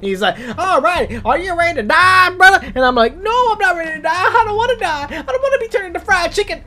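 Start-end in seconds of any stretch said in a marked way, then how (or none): 0.6-2.7 high cartoon villain taunt, getting louder; loud, pitched-up menace. Villain taunts before firing laser. 3.2-6.6 high-pitched mock begging; hammed-up frantic whine; panicked pleading. Acted begging for life.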